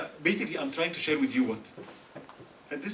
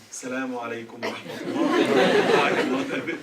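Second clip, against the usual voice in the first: drawn-out mock greeting; 5 semitones lower, 8 dB louder, slower than usual. Mocking long voicemail greeting.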